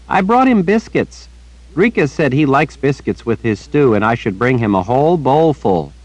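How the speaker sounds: put-on high, earnest little girl chattering; bright-eyed child repeating gossip to the old wizard. A narrator's reading. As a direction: pitch it up like a young girl telling news. Child Rachel voiced.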